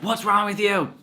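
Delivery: husband finally snapping at his wife, high and loud; exasperated outburst. Retold or imitated. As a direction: burst out at your wife. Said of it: "I was just like".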